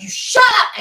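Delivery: high, loud "shut up", mock outraged; jokey indignant shout; playful shout. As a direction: yell it back at the teasing friend. Playful retort at friend's teasing.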